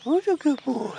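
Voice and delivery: high squeaky pet-praise voice; squeaky praising a dog. Pretend. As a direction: coo at it like a puppy. Talking to a cicada like a pet.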